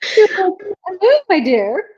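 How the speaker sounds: high-pitched, playful British greeting; British-accented chicken. Acted. Imitating a chicken she talked to, British accent. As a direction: lightly put on a British chicken's voice. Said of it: "she had a British accent".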